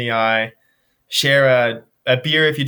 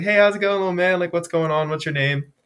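put-on friendly hello to a kid; 5 semitones higher than usual. Greeting a shy young boy.

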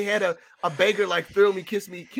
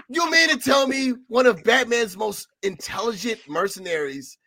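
ordinary talk

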